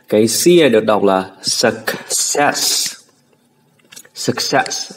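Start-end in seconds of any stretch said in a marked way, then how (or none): 1.4-4.9 "success" with light hissed s sounds. Shown as a demonstration. Teacher models it for students, light S. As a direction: keep each s in "success" thin, never sh. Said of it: "âm sờ mà tôi đọc ra là một âm sờ".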